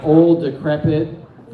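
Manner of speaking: ordinary talk, nothing marked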